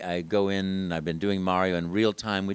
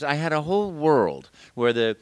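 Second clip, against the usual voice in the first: usual speaking voice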